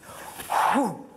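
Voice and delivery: sharp breath sound, imitated; sudden whoosh. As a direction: imitate a sudden rush of air. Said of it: "all of a sudden there was this".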